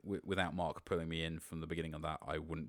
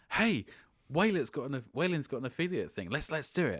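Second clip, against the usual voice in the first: excited; 9 semitones higher, 6 dB louder than usual. Retold or imitated. Retelling his own eager pitch.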